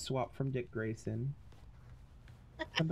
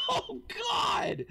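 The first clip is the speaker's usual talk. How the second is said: laughing cry. Real, not acted. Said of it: "I think you snapped".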